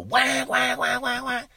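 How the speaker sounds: loud imitated duck quacks; duck call honks. Shown as a demonstration. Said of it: "those loud call".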